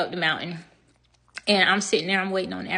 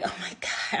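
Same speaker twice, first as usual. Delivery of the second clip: breathy whisper of despair; 6 dB quieter than usual. Retold or imitated. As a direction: whisper it to yourself. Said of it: "I'm like".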